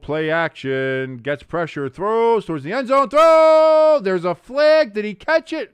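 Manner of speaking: rising to a shouted call as the ball flies to the end zone; excited play-by-play. Acted. Streamer calling the game for chat, excited play-by-play yell. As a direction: call the throw like a live broadcaster, building to a yell.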